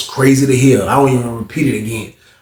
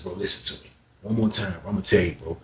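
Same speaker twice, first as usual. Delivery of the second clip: softer, lower serious advice; hushed warning; 4 semitones lower, 9 dB quieter than usual. Retold or imitated. Narrator warning cellmate for the last time, hushed. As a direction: lean in and warn him quietly.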